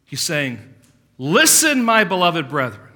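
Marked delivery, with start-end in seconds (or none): 1.2-2.9 high, loud indignant call to the brethren; preacher voicing James's indignant plea; indignant appeal. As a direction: demand their attention, indignant. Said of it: "James is becoming more indignant now".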